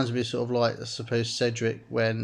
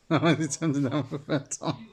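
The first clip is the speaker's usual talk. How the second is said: laughing; 2 semitones higher than usual. Real, not acted. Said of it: "I'm laughing my ass off".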